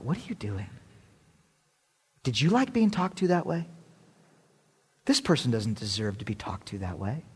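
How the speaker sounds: soft, low-key inner voice of God; hushed, searching questions; gentle rebuke. Acted. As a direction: speak softly, as a quiet prompting in the heart. God's gentle rebuke voiced in story.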